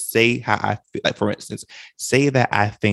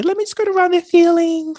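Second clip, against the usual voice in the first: mocking voice; 4 dB louder than usual. Mocking an overly careful approach.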